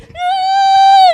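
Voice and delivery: a sudden high sob-wail; high crying wail. Retold or imitated. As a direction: let out a high wailing cry. Breaks down after the call. (gemido agudo de llanto)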